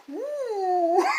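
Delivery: high-pitched "woo" whoop. Real, not acted. Vlogger relishing the Golden Globes speech, high whoop. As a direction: let out a long high whoop. Cheering the awards takedown.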